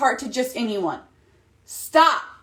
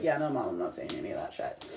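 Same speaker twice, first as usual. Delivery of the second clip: put-on much lower, softer, fast kid brush-off; imitating dismissive son; 13 dB quieter, faster than usual. Son brushing off his mom's suggested line, low and fast. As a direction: toss it off low and quick. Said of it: "he was like".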